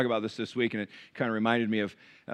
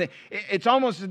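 ordinary talk

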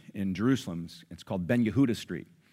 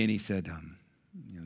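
ordinary talk, nothing marked

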